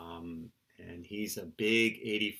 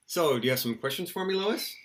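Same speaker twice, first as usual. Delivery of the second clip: usual speaking voice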